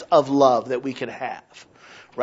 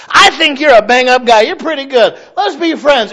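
higher, louder hearty buddy tone; mock chummy voice; 8 semitones higher, 7 dB louder than usual. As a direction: slap them on the back with fake cheer. Mocking fake friendliness.

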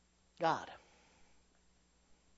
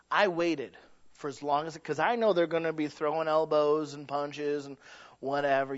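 ordinary talk

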